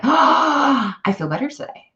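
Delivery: gasp, then a high-pitched "Oh"; high gasped realization, easing off; pleasant surprise. Acted. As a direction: act out waking up relieved, starting high. Acting out waking up feeling better.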